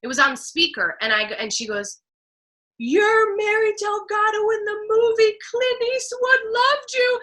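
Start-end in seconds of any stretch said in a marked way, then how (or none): 2.8-7.2 high, slow, sing-song delivery of big news; triumphant announcement. Retold or imitated. Agent on speakerphone telling actress she got the part, triumphant. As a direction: draw it out high, like announcing a prize.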